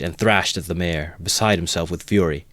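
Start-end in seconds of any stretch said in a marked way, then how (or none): none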